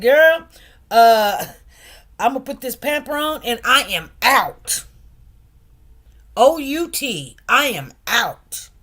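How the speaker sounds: hammed-up retelling of quitting to a coworker, loud and final; loud quitting declaration. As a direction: announce you're done and leaving, loudly.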